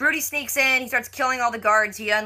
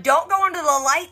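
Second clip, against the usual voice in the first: high, louder cry of warning; mock warning; 8 semitones higher, 5 dB louder than usual. Viewer warning Sarah off the light, mock-urgent high call. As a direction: call out a playful plea, pitched up.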